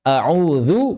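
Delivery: "A'udzu" with a buzzed tongue-tip dh; tongue between teeth for "dzu" in "A'udzu"; correct dhal. Shown as a demonstration. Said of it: "banyak baca".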